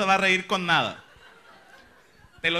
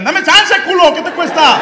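shout, exasperated, pleading and insulting; angry yell; 9 semitones higher, 10 dB louder, slower than usual. Retold or imitated. Yelling at the driver who won't let him in, an angry shout. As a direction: yell it high and loud at the driver.

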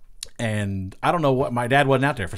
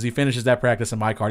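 ordinary talk, nothing marked

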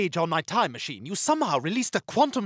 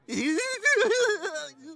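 high laugh; 13 semitones higher than usual. Acted. Laughing sound.

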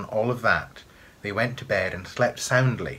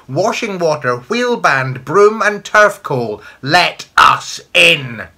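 loud, high witches' howl; high, full-voiced wailing command; hideous howling; 9 semitones higher, 11 dB louder, slower than usual. A narrator's reading. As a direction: howl it out, slowing to a drawn-out demand. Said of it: "a score of hideous voices howled".

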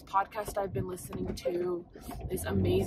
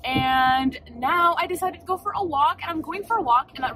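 excited; 6 semitones higher, 8 dB louder than usual. Real, not acted. Excited vlog announcement.

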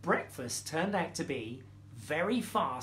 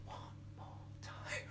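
whispered call; breathy unvoiced whisper; 19 dB quieter than usual. Shown as a demonstration. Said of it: "you have to do this".

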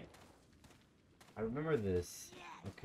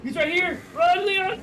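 very high, very loud yell; terrified scream; 14 dB louder than usual. Real, not acted. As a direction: shriek a warning to Leon. Enemy right behind.

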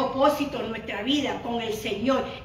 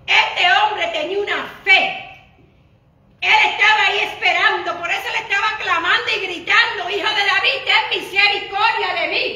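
loud, high preaching with a laugh; fervent preaching; 6 semitones higher, 6 dB louder than usual. Acted. Pastor telling the church of the blind man crying out, loud preaching. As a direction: proclaim the blind man's faith loudly.

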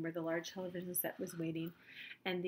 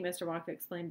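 plain reading voice

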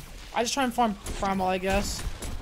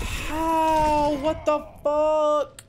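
disbelieving; 6 semitones higher, much slower than usual. Real, not acted. Disbelief after losing fight.

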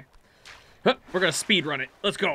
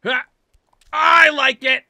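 high, loud, stretched-out exclamation; gleeful cheer; 6 semitones higher, 8 dB louder, slower than usual. Real, not acted. Player pleased with a lucky grab, gleeful shout. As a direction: crow with delight.

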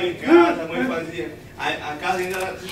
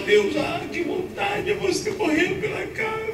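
mock longing prayer; 11 semitones higher, 3 dB quieter than usual. Parent praying over sick child.

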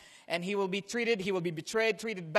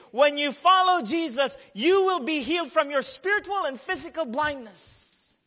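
louder, higher preaching voice; 6 semitones higher, 6 dB louder than usual. Acted. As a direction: press the point home with force. Preaching with force.

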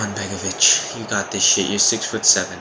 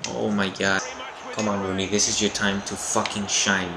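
ordinary talk, nothing marked

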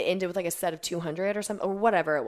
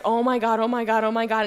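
raised, louder repeated fretting; panicking; 4 semitones higher, 6 dB louder than usual. Retold or imitated. Athlete retelling her panic on video, mock frantic. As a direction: re-enact mild panic, phrase after phrase. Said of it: "I'm panicking".

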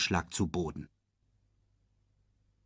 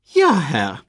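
dünne Stimme; 7 semitones higher, 8 dB louder than usual. A narrator's reading. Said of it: "mit dünner Stimme".

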